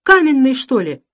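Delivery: high, loud spike on the middle word; indignant disbelief, nearly shouting; exasperated outburst. A narrator's reading. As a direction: lunge at him with the question. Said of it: "бросился к нему Самсонов".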